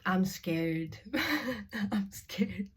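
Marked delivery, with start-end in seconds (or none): 1.1-2.7 higher, laughing through the words; laughing admission. Real, not acted. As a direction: admit it through a nervous laugh. Laughing while admitting being scared.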